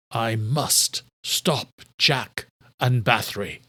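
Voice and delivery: slow, determined king; grim vow. A narrator's reading. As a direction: vow it slowly. King vows.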